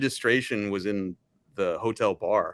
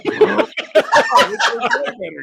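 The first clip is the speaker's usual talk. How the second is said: laughing; 9 dB louder than usual. Retold or imitated. Mock realization with laughter.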